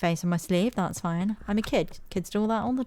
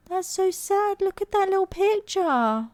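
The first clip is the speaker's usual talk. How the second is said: cooing sympathy; 12 semitones higher than usual. Pretend. Player cooing at the kid's picture, high cooing sympathy. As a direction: coo over it like a doting gran.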